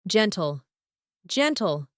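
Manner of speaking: "Gentle" starting with a j sound; soft G. Shown as a demonstration. Teacher giving test answer, soft G in "Gentle". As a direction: open "Gentle" on j, as in jen-tl. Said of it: "Letter G sounds like G".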